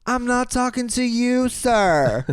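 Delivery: high, drawn-out mock exasperation; hammed-up retort. Friend brushing off the nosy man, hammed-up retort.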